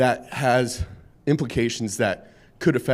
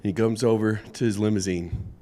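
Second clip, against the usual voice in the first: usual speaking voice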